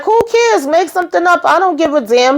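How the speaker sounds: high-pitched, impatient brush-off; mock dismissive. Boss brushing off the cops' question, mock dismissive. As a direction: act out an exasperated boss, pitched well up.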